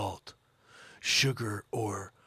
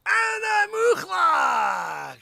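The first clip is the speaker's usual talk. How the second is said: very slow, much higher drawn-out yell; hammed-up long bellow; stretched shout; 8 dB louder, much slower than usual. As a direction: drag the name out in a big call.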